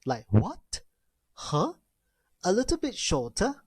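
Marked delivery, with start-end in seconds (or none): 0.3-1.7 short "What? Huh?", each going up; questioning upward lift on "What?" and "Huh?"; rising tone. Shown as a demonstration. Teacher demonstrates tone two, short rising "What? Huh?". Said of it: "but it is a rising tone".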